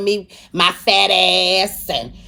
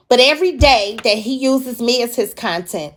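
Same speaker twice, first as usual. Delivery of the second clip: ordinary delivery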